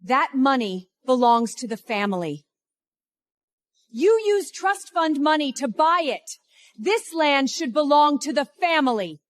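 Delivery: high-pitched indignant demand; indignant relative. Acted. Relative claims the land for the family, high and indignant.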